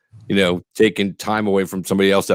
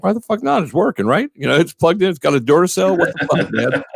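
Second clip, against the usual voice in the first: pitched way up, outraged; high indignant outburst; 9 semitones higher than usual. Retold or imitated. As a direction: squeal it out in disbelief. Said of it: "I'm like".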